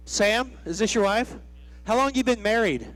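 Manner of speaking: ordinary talk, nothing marked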